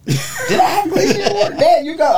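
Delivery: high laughing question; laughing disbelief. Real, not acted. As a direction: crack up doubting yourself. Laughing self-doubt.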